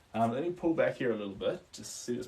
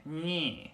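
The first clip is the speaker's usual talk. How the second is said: long, drawn-out "meh" noise; slow "meh", like a bee in the bonnet; mimicked Australian whine; 5 semitones higher than usual. Shown as a demonstration. Streamer mimicking how Australians sound to viewers, drawn-out noise. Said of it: "Australians sound more ... bee in their bonnet".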